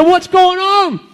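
high, bewildered outcry; astonished cry. Acted. Bush baffled at burning unharmed, astonished cry. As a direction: cry out in hammed-up amazement.

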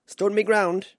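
loud, high, punched out; proud boast. Real, not acted. Amateur boxer boasting after facing a champion, loud and proud. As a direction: declare it boldly.

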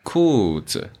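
子 sinks after 裤; neutral tone demo. Shown as a demonstration.

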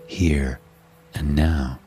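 usual speaking voice

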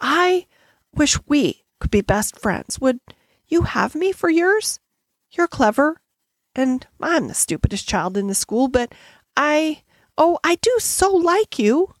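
high, halting, heartfelt plea; earnest shy appeal. A narrator's reading. Shy schoolgirl asks Sarah to be best friends, earnestly pleading. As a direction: blurt out affection between hesitant pauses.

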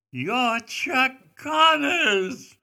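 woman at the steakhouse gushing at Chuck, high and slow; starstruck fan. Retold or imitated. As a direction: squeal it like an excited grandmother spotting a star. A fan recognizes the star.

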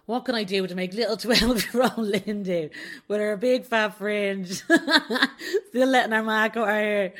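real laughter breaking in; laughing. Real, not acted. Laughing at her younger self.

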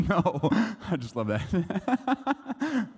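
very slow, laughing through it. Real, not acted. Preacher laughing at his own joke. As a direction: laugh out the words.

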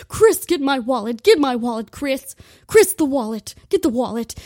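loud put-on mom voice demanding the wallet; yelling mom. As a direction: shout at him to get the wallet. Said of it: "yelling at my dad, like".

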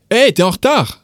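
calling out. Pretend. Yelling at your mate who is late, calling out. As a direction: call out to your mate across the street. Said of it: "if you're yelling at your mate".

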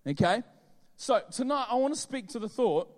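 ordinary delivery

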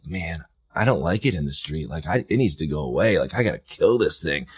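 hushed and uneasy, said to himself; quiet muttering to himself. Retold or imitated. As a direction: mutter it softly under the breath.

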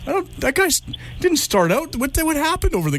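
mock bewilderment rising into a high voice; puzzled caller. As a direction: imitate a baffled man, pitch climbing high. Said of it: "getting off the phone going".

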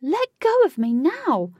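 high, loud, drawn-out demand; screaming. A narrator's reading. Katie to Huey holding her, screaming. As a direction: scream to be released. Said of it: "screamed Katie".

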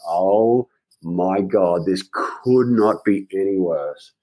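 slow dread. Retold or imitated. Dread at being summoned.

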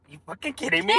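mock disbelief. Retold disbelief at friend's text.

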